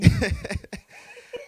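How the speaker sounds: high, loud burst of laughter; real laugh. Real, not acted. Interviewee reacting to host saying it was not easy, high laugh. Amused by listener's sympathy.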